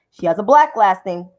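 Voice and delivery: normal talking voice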